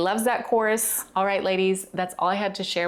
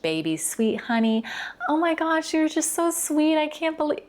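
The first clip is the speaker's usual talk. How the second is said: put-on squeaky, much higher gushing baby talk; over-the-top sweet baby voice, far higher than her own; high-pitched baby voice; 11 semitones higher than usual. Shown as a demonstration. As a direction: gush like a sugary caricature. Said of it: "don't automatically go to a high-pitched".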